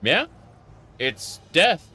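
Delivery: ordinary delivery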